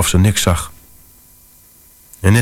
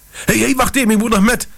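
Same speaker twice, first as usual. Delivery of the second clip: high hammed-up yelling in a far higher voice; mock yell; faster than usual. Narrator shouts at the station official to wait, a mock high yell. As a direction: shout after the closing doors, overacted. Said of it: "schreeuwde ik tegen de beambte".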